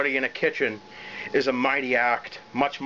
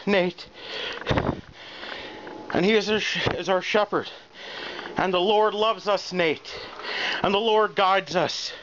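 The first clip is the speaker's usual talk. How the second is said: preaching joy; 5 semitones higher than usual. Acted. Preaching his triumph to Nate.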